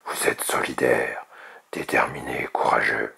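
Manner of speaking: deep, solemn ruler's voice; dragon king. A narrator's reading. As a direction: praise them in a low kingly rumble. King praises the young dragons.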